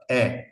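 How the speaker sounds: E as a wide open "eh"; open vowel. Shown as a demonstration. Teacher isolating the missing vowel, E said open. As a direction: open the mouth on E, like Portuguese é. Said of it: "no existe vocal abierta".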